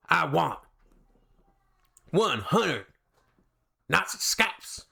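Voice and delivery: hammed-up Brad Pitt film impression, accent laid on thick; Aldo Raine. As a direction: bite out the order word by word. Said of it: "Brad Pitt and Inglourious Basterds squeaked in there".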